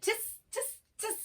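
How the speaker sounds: high, soft monkey chitter; little high-pitched tis sounds; mimicking monkeys. A narrator's reading. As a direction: squeak back teasingly like monkeys. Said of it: "the monkeys only shook both their hands".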